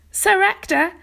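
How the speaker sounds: high, loud breathless call; excited outburst. A narrator's reading. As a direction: burst in calling his name. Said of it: "burst into Sir Ector's private chamber".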